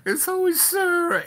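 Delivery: very high, mock-weepy voice, slowing at the end; near tears. Lawyer moved at being called Bob, mock tearful. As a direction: imitate a man overcome, squeaking up high. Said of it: "he almost starts crying".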